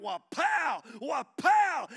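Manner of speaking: loud, high shouted whip cracks; whip-crack imitation. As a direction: explode on it like a cracking bullwhip.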